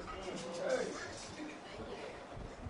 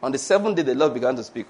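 mock self-righteous; 7 semitones lower, 6 dB quieter than usual. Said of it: "this self-righteousness".

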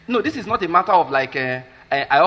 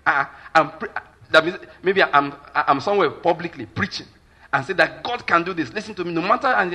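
usual speaking voice

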